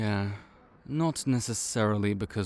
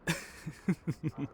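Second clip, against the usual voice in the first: soft laugh; 8 semitones higher, 4 dB quieter than usual. Acted. Amused at listener's question.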